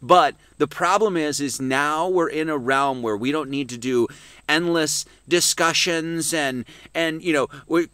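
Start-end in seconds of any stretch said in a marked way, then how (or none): none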